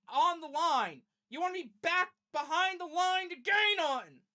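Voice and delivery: high, loud, incredulous rant; exasperated yelling. Real, not acted. Fan berating the failed fourth-down play, exasperated yelling. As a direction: yell in real disbelief at the play call.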